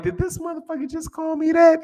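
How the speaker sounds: high, shocked mock disbelief; squeaky outraged double take; incredulous. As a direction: act out being stunned by an insult. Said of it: "'cause I was like".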